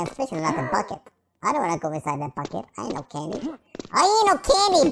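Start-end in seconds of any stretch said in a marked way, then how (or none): 3.9-4.9 raised protest. Pretend. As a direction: say it higher and fuller, insisting. Repeats the line in protest.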